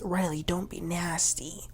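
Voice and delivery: whispered, voiceless warning; hushed whisper. Retold or imitated. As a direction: lean in and whisper it sharply. Gia hushing Riley at table.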